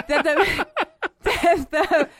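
high, breaking into laughter; cracking up. Real, not acted. Host complains about co-host's accent, laughing too hard to continue. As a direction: try to protest through real laughter.

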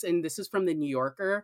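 ordinary delivery

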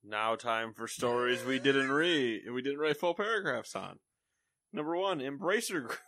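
slow, drawn-out segment intro; announcer. Acted. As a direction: announce it like a show host.

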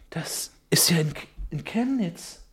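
unvoiced stunned whisper; whispered shock. Reborn Milbea whispering at waking in Chemnitz. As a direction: breathe it in disbelief.

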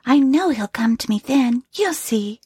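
quoted wife. Retold or imitated. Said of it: "she says".

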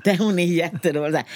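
ordinary delivery

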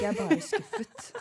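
high, slow mock with a laugh; laughing dad line. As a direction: parody the disappointed father, laughing through it. (ler mens hun etterligner en skuffet pappa)

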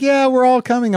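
high, loud eager reply; high, excited dad impression. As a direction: imitate the happy dad cheerfully. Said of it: "He's like".

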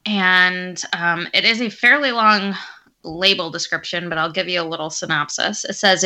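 ordinary delivery

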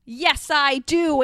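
loud, drawn-out insistence; half-hearted screech, loud and slow; mock screech. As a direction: stretch it out loudly, short of screeching. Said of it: "Aunt Petunia screeched".

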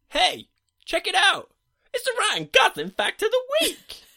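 very high, loud squeaky announcement. Acted. Host announcing the weekly fact segment, in a very high squeaky voice. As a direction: squeak it out like a jingle. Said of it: "gets more and more squeaky".

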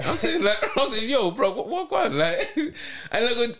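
high, laughing through words; cracking up mid-story. Real, not acted. Laughing while telling a funny story.

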